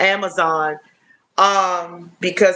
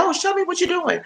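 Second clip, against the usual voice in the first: much higher, lightly voiced young boy; imitating her son; 11 semitones higher, 3 dB quieter, faster than usual. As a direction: mimic an eager kid asking to see. Mom voicing her son's request.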